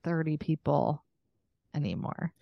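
ordinary delivery

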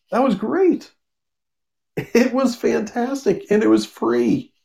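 excited. Real, not acted. Reviewer raving to viewers about a free comic, excited and high. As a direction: rave about it, pitch way up.

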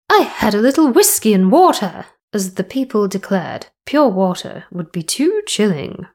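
high, loud plummy queen voice held throughout; posh voice. Acted. Podcaster retakes the Queen's diary in a posh voice. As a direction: read like a grand Victorian queen. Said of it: "I will read this in a posh voice".

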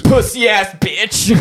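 high, drawn-out shouted American caricature; mock American accent. Friend topping the American impression, high shouted American accent. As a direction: shout it slowly as an exaggerated American. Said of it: "other people do American accents".

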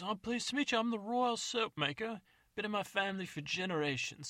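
put-on a greedy old codger eager for the carcass; old man. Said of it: "He goes".

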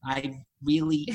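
put-on New York American accent; Woody Allen. Shown as a demonstration. Trying the host's line, New York American accent. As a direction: try an American accent that turns New Yorker. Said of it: "you're a bit Woody Allen".

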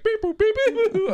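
very high, silly robot-ish voice; squeaky beep boop mockery; goofy phone babble. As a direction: babble nonsense at your phone. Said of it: "he's like".

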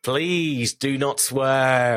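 very slow, exaggerated British regional accent; off-the-mark British regional accent, SWEAR drawn out. Shown as a demonstration. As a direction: stretch every word in a mock British regional accent. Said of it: "Your idea of British regional accents".

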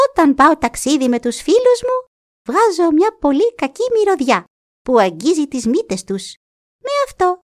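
high boastful mink voice. Acted. Mink boasting of its smell, high and proud.

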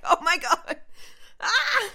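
high, loud startled scream; panicked shriek. Retold or imitated. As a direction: recall the jolt of panic out loud. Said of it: "I was like".

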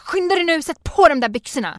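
sharp high roar to hurry up; snapping at child. Retold or imitated. Stressed mother roaring at toddler to hurry, loud and high. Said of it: "man så här ryter till".

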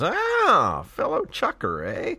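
high squeaky mock greeting; high goofy voice. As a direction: pitch way up, cartoonish and silly. Voicing a character's greeting.

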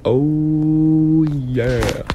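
drawn-out crooned oh yeah; smooth oh yeah. Shown as a demonstration. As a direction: slide into it lazily. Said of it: "You know who else did that "oh, yeah" voice?".

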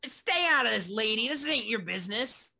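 loud, high-pitched tough telling someone off; angry brawler. Acted. Brawler warns the interfering woman off, loud and angry. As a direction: snap loudly at a meddler. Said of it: "One of them looks over".

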